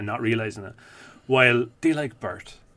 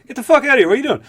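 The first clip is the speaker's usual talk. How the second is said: higher, louder mock yell; yelling Patrice; 11 semitones higher, 6 dB louder, much faster than usual. Patrice yells at Kevin Hart, mock yell. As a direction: bark it like catching someone in the act. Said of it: "He's like".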